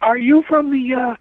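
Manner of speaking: hint of a questioning lady; lady with a cane. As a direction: lift the pitch a little for a retold lady's question. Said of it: "a lady comes out with a cane".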